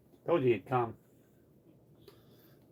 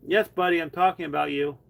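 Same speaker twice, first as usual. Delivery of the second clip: higher, louder sing-song to the cat; pet talk; 4 semitones higher, 7 dB louder than usual. Real, not acted. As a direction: coax him with fondness. Answering the cat fondly.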